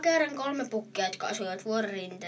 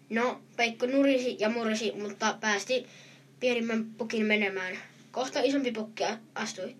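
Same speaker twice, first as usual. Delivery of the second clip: plain reading voice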